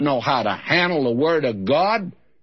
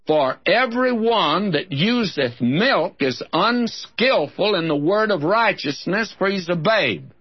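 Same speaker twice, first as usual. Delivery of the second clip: higher, measured Bible reading; scripture reading; 5 semitones higher than usual. Acted. Preacher reading Hebrews to his listeners.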